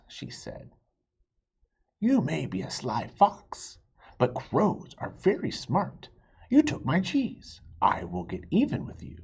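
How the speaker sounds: lively, expressive read-aloud; reading to children. A narrator's reading. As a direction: tell it like a picture book to kids. Reads story to children.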